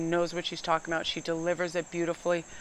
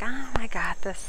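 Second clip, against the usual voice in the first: slow, higher, self-mocking swagger; mock confident; 5 semitones higher, 4 dB louder than usual. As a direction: play up the bravado, slowly. Mocking her own confidence.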